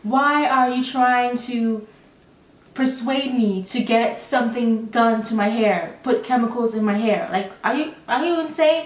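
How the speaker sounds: ordinary talk, nothing marked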